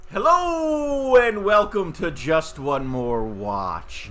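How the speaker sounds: soaring high, stretched show-host hello, then slowing down; Jody's enthusiastic greeting. Retold or imitated. YouTuber borrowing another host's opener, imitating his high enthusiastic hello. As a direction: belt out the greeting like an overexcited channel host. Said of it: "I didn't do it nearly as enthusiastically".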